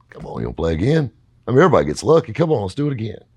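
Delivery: loud, high, coaxing taunt; baiting hustler. Retold or imitated. Peg goading the kids to bet again, loud and coaxing. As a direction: egg them into another game.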